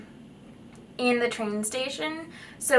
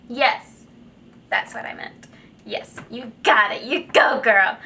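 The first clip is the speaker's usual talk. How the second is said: mock sassy reply; 5 dB louder than usual. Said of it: "I didn't say that. I promise I'm not that crazy".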